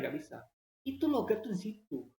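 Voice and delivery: ordinary talk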